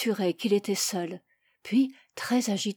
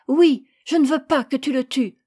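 put-on louder, higher child's protest; urgent, emphatic refusal; defiant plea; 4 semitones higher, 6 dB louder than usual. A narrator's reading. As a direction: insist against a father's will. Child defies father to save lamb.

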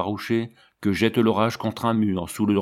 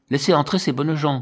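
a raised, higher call to the gatekeeper; 5 semitones higher than usual. A narrator's reading. Brother Siméon calling to the porter to admit the villagers, raised higher call. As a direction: call out to let the good folk in. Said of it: "cria le frère Siméon".

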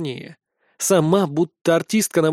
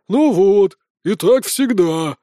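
drawn-out, offended complaint; hurt grumble; 4 dB louder, slower than usual. A narrator's reading. Scolded fans complaining back, hurt. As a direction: stretch it out in a sulk. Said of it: "раздаются с обидой".

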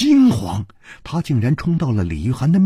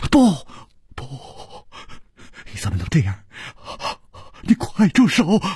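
panicked, breath-broken cries; trembling plea; 3 semitones higher, faster than usual. A narrator's reading. Li Yuhan restrains Liu Wumei from the dagger, trembling and desperate. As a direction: beg in gasping bursts, ending high. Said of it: "颤声说".

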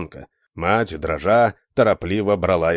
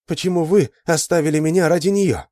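raised, pleading cry; exclaiming; 8 semitones higher than usual. A narrator's reading. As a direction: cry out in reproach. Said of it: "воскликнула она".

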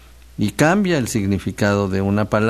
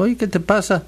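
higher-pitched friendly check-in; concerned question; 9 semitones higher than usual. Retold or imitated. Host asking a downcast friend what's wrong, concerned. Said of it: "le pregunté".

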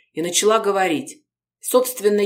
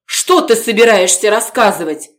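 loud, higher alarmed yell; shouting; 4 semitones higher, 8 dB louder than usual. Acted. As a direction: shout to stop her. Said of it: "закричал Городецкий".

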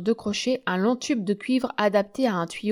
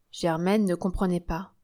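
plain reading voice

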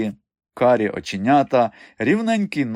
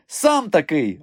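mild snap back, pitched higher; snappish retort; 6 semitones higher, 3 dB louder, slower than usual. A narrator's reading. Mikha answering his friend while fixing his collar, snappish retort. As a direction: toss the insult straight back. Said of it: "огризнувся Міха у відповідь".

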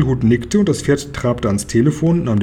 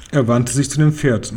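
ordinary delivery, nothing marked

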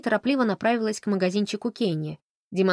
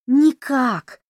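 delighted; 7 semitones higher, 8 dB louder than usual. A narrator's reading. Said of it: "обрадовалась Фанни".

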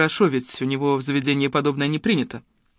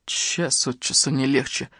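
low, slow, soft exhale of dismay; weary sigh of words; 4 semitones lower, 6 dB quieter, slower than usual. A narrator's reading. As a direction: breathe it out heavily, slowly. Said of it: "выдохнул Славик".

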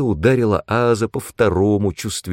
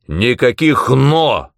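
loud, slow, higher bark; stern, raised order; barked retort; 5 semitones higher, 5 dB louder, slower than usual. A narrator's reading. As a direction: shut down the objection forcefully. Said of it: "рявкнул он в ответ".

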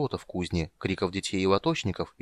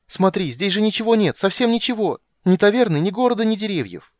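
high, loud eager insisting; excited; 11 semitones higher, 7 dB louder than usual. Acted. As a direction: rattle it off, thrilled. Kender eagerly insists nothing's there. (возбуждённо, громко)

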